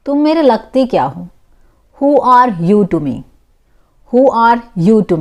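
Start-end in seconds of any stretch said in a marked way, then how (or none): none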